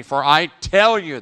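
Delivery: "tell" punched loud; loud preacher's declaration; loud emphatic quote. Acted. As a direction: ring out "For I tell you". Quoting Jesus emphatically.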